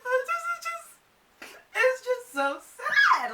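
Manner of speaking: very high, drawn-out tearful squeal; hammed-up weepy whine, slow; mock crying. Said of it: "what are you crying about".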